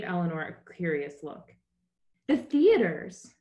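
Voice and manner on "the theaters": excited. Acted. Eleanor eager to see theaters.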